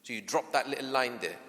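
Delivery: ordinary talk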